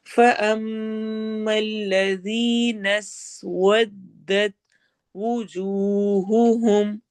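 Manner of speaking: slow chanted recitation with doubled consonants held; Quranic recitation. Shown as a demonstration. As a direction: chant it slowly, holding every shadda. Teacher recites Quranic verse.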